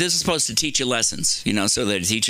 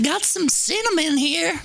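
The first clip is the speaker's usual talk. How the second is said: mock hooker. Imitated hooker at window.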